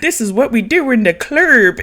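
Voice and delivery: high, loud mock quote; mocking imitation. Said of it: "is what he said".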